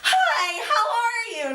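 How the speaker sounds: high, laughing and half crying hello; laughing high greeting. Retold or imitated. Dan greeting the starstruck fan, laughing high. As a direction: laugh through it, overwhelmed. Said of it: "And Dan's like".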